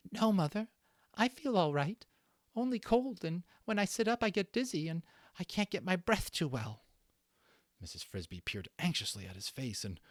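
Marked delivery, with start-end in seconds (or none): 0.1-6.8 put-on higher voice for a feverish little boy mouse, a touch weak; a sick child reassuring his mother, pitched up; sick young mouse. Read-aloud story, Timothy answers.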